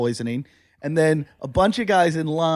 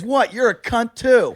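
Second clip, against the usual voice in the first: raised-pitch retort, drawn out; imitated heckler; 7 semitones higher, 4 dB louder, slower than usual. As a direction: lightly act out a cheeky heckler answering back. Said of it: "And he goes".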